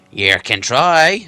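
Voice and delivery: loud, slow menacing troll; troll Bert. Acted. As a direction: growl the dare slowly.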